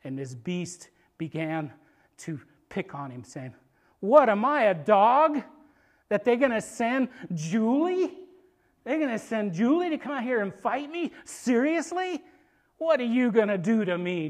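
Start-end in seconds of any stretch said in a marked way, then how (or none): 4.0-14.3 loud, raised, incredulous mockery; emphatic, sneering questions; scornful taunting. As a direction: act insulted by a puny challenger. Giant mocking his small challenger.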